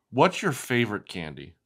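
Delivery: usual speaking voice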